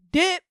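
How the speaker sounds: sharp, high, loud "dip"; sudden exit effect. Acted. Showing her mind bolting, sudden loud burst. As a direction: pop the word out abruptly.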